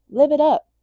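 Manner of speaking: a sudden loud, high burst of cheer; excited. Acted. Vlogger urging her viewers to live loud, excited. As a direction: shout it out like a toast.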